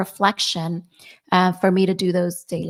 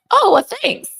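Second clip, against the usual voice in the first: high laughing mock-gracious thanks; laughing sarcastic thanks; 6 dB louder than usual. To a relative who called her fat, laughing sarcastic thanks. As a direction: laugh it off with a high, fake-polite thank you.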